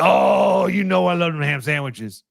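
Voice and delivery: imitating a Cajun coach, hammed-up; Coach O impression. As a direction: growl it like a Louisiana football coach. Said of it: "He's like".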